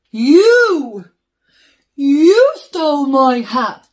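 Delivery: loud furious accusation; angry accusation. Acted. Bear angrily confronting the rabbit who stole his hat, shouting. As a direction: blast it at the thief.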